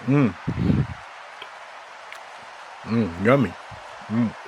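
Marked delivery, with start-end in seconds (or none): none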